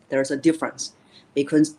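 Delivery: normal talking voice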